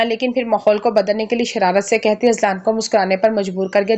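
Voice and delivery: plain reading voice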